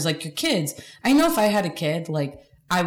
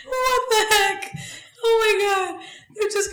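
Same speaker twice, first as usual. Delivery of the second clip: laughing disbelief; slower than usual. Real, not acted. Laughing high after joke.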